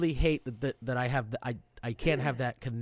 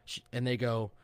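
ordinary talk, nothing marked

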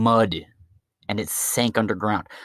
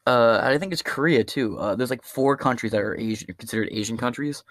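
ordinary talk, nothing marked

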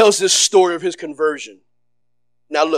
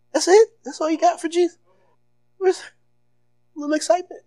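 much higher, incredulous "that's it?"; mock disbelief; 10 semitones higher, faster than usual. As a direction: tease them for the weak praise, pitched way up. Mock complaint about dull worship.

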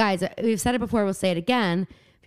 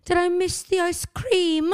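high, slow accented line with rolled R; mock foreign accent; 8 semitones higher than usual. Shown as a demonstration. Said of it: "you didn't say it in the accent".

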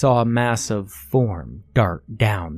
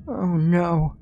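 higher worried gasp of words; dismayed; 8 semitones higher than usual. Acted. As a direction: let the dread slip out. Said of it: "Natalie uttered".

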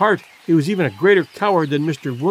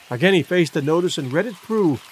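ordinary delivery, nothing marked

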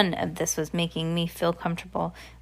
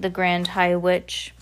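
ordinary delivery

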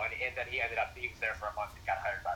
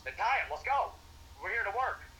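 a stern coach's higher, clipped order, retold; 9 semitones higher than usual. Coach scolding assistant over untied shoes, mock stern order. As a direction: snap the order like a strict coach telling off a young assistant. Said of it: "And it was so stern".

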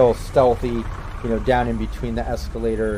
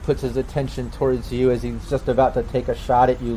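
ordinary talk

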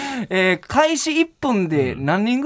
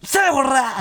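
angry yell; 6 semitones higher, slower than usual. Retold or imitated. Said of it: "って言われて".

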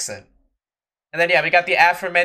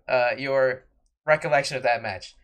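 usual speaking voice

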